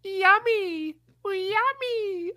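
silly high voice. Pretend.

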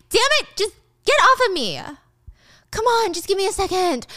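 put-on high, irritated young woman; annoyed Stacy. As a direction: push him off in a high annoyed voice. Stacy voiced pushing him off.

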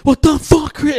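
high, loud mock yell; outraged outburst. Classmate whose coke just went flying, outraged.

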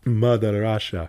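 mock heavy Russian accent. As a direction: proclaim it like a joke Russian. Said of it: "it sounds like a Russian accent".

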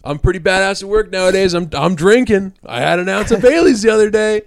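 retold cocky blurt; blurted boast. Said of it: "I just randomly blurted".

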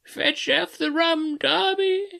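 very high, slow, drawn-out phantom wail; ghostly wail. Acted. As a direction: wail the name like a distant ghost. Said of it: "rising a little higher".

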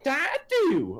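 high, silly commentator squeal; Lawler impression. Fan mimicking a third commentator amid the chaos, high mock squeal. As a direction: squawk it way up high, mockingly. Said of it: "Lawler was just like".

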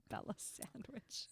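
breathy giggle through words; laughing whisper. Real, not acted.

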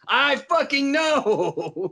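slow, high-pitched knowing tease; long, exaggerated "know"; drawn-out agreement. Real, not acted. Listener teasing, drawn out, laughter.

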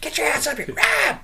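very high mock yell; Stacy yelling. Host imitates someone calling him up to help, high-pitched yelling. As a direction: holler upstairs as an annoyed housemate. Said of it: "I could hear Stacy yelling out".

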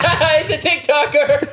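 mock pointing jeer. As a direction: imitate gawkers laughing at a celebrity. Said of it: "pointed at me and been like".

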